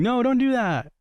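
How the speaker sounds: high-pitched mock plea; frantic ghost.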